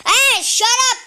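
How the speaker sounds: sudden loud, high yell of shut up; yelling at a sister who walks in; shouting at sister. Real, not acted. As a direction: snap it loud and high at someone interrupting.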